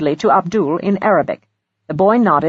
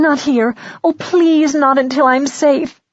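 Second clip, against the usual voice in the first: high, urgent begging; frightened plea; 8 semitones higher than usual. Acted. Trapped young woman begging to wait until safe, high and pleading. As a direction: beg to be spared the question. Frightened girl begs not to name target.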